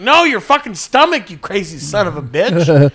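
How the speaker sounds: loud outburst, starting high and dropping; exasperated mock yell. Onlooker correcting clueless farm kid, exasperated yell. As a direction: set them straight in hammed-up exasperation.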